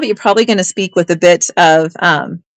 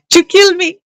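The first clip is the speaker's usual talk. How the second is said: laughing repeat; 10 semitones higher than usual. Pretend. Seller repeats it to the chat, giggling. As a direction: still high, giggly second take.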